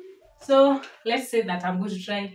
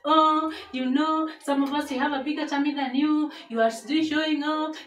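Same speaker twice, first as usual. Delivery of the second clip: high teasing whiny voice; mocking critics; 7 semitones higher than usual. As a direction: mimic the snide commenters. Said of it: "you start saying that".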